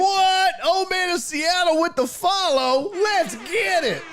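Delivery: high, excited shout-out to a new follower; big high-pitched hype, stretching the last words; hyped celebration. Real, not acted.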